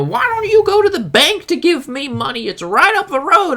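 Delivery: very high, put-on nagging yell; mock yelling. Man demanding money from the storyteller, mock high-pitched yelling. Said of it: "cursing, yelling, you know".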